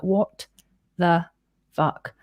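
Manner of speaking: word by word, with long gaps; stunned. Retold or imitated. Woman alone on her bed after the message, stunned. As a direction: re-enact dazed disbelief, a pause after each word.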